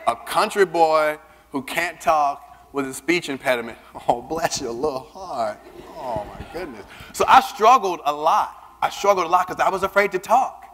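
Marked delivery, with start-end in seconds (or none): 4.1-6.9 mock Southern pity. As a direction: pity the poor boy, condescendingly. Mock pity for stuttering country boy.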